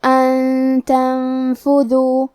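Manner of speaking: "ان تنفذو" very slowly, each ن held as nasal hum; ghunnah recitation. Shown as a demonstration. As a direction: chant "ان تنفذو", hiding the noon in a long hum. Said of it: "یہ حکم کو غنہ کے ساتھ".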